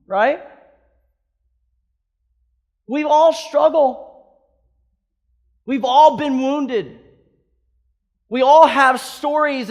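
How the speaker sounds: loud, high, impassioned preaching; a pastor pounding home the message; full-force preaching. Acted.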